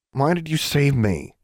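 slow uneasy asking; reluctant question. A narrator's reading. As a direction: force the words out. Hard admission.

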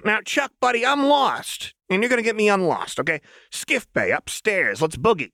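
put-on high, sing-song playful girl; high chirpy menace; manic Jinx voice. A narrator's reading. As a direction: tease the hostage brightly.

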